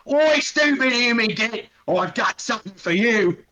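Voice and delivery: an orc jeering as he charges from behind; taunting orc. Acted. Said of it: "he says".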